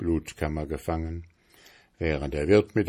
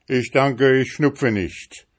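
put-on higher, curt young man's reply; dry refusal; 6 semitones higher, 5 dB louder than usual. A narrator's reading. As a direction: decline with dry wit. Journeyman declines the snuffbox.

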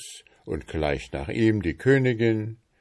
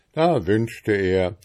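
plain reading voice

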